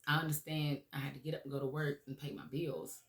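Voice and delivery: usual speaking voice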